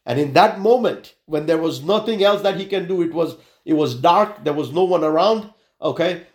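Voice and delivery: usual speaking voice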